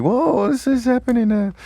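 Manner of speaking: high, bemused inner voice; mock bafflement at a text; baffled. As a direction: squeak out puzzled disbelief. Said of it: "staring at text and thinking".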